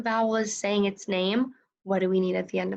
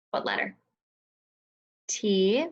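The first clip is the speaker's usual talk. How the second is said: ordinary delivery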